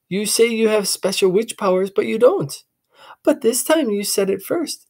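put-on high, doubting young boy; boy challenging his sister; suspicious boy. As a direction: argue like a skeptical kid.

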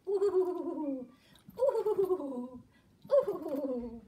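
loud high wind howl; howling wind noise. Acted. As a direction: make the snowstorm wind howl. The snowstorm wind howls.

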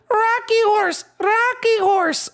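put-on high, drawn-out toddler falsetto; baby ghost. Baby ghost absorbed in rocking horse, babbling. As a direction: chant it in baby talk.

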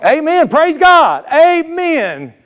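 high, ringing shout of praise; soaring church exclamation, drawn out at the end; exultant praise. Acted. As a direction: cry it out like a revival amen. Preacher exclaims praise over voiced congregation's complaint.

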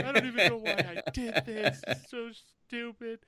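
high tearful wail, played for laughs; mock crying. Actor recalling crying late at night, mock crying. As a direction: imitate yourself blubbering in despair. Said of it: "And I'm just crying".

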